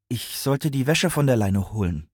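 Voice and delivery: put-on embarrassed child explaining himself; ashamed. A narrator's reading. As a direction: own up shamefacedly. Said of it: "sagte er beschämt".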